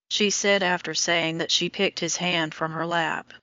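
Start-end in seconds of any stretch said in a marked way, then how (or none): none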